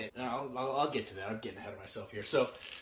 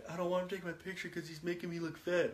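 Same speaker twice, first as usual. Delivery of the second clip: lightly voiced upset kid; 3 semitones higher, 6 dB quieter, faster than usual. Son explains why he's upset.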